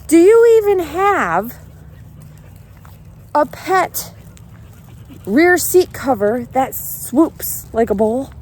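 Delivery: high loud incredulous questioning, slowed; exasperated shout. Retold or imitated. Driver challenges old man who took her car, loud incredulous questioning. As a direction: demand it in disbelief.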